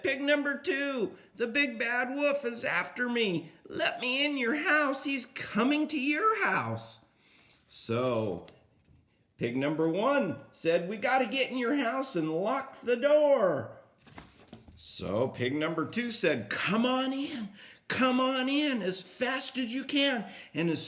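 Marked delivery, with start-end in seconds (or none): 0.0-7.0 much higher pleading pig voice; frantic little pig. Acted. Pig one begging pig two to hide him, high frantic voice. Frightened pig begs entry. 10.9-13.7 much higher pleading pig voice; frantic little pig. Acted. Pig one begging pig two to hide him, high frantic voice. 16.5-20.2 much higher urgent pig voice; little pig. Acted. Pig two hurrying pig one inside, high urgent voice.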